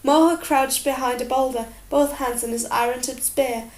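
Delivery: usual speaking voice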